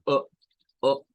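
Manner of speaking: o cut short with a glottal stop; clipped o sound. Shown as a demonstration. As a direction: chop the o off short. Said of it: "la O con un glottal stop". (una o recortada, como rebanada)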